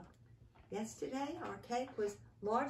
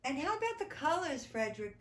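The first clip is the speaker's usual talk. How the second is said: anxious; 6 semitones higher, 4 dB louder, faster than usual. Acted. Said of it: "they asked anxiously".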